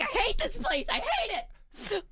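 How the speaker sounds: high whiny complaint, stretched out; mock whiny wail. Mother whining about her ice-cold house, high and whiny. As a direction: wail it like a freezing, fed-up kid.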